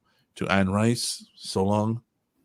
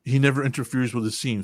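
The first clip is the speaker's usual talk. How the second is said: ordinary delivery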